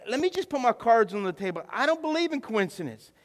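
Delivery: high, loud, full-force sermon delivery; impassioned preaching. Real, not acted. Pastor declares belief in providence to church, impassioned preaching. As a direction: preach it out hard, punching key words.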